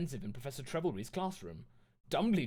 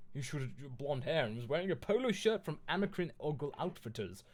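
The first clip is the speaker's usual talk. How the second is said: plain reading voice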